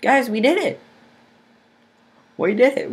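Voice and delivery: high, loud victory yell; victory shout. Real, not acted. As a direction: shout the win to the viewers. Raid won, celebrating.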